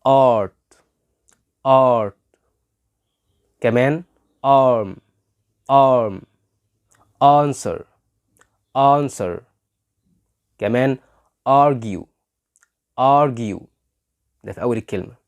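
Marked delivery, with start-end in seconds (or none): 0.1-2.4 "art" opening on a long, loud "aah"; "art" as "aaht", vowel held. Shown as a demonstration. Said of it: "الصوت ده يجي لك في أول الكلمة". 4.5-9.4 "arm" and "answer" starting on a held "aah"; long initial AH. Shown as a demonstration. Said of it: "الصوت ده يجي لك في أول الكلمة". 11.5-13.6 "argue" as "AAH-gyoo", first vowel held; long initial AH. Shown as a demonstration. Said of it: "الصوت ده يجي لك في أول الكلمة".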